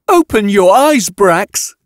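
high, urgent scream over someone. A narrator's reading. Yatena cuts off her fellow scientist, screaming at him. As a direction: cut in with a high-pitched yell. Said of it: "Yatena screamed".

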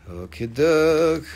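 slow, put-on silly voice; host clowning between items; goofy okie doke. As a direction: drag out okie doke in a funny voice. Playful slow say.

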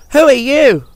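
high, loud, quick question; curious little engine. A narrator's reading. Percy asks the strange helicopter its name, curious.

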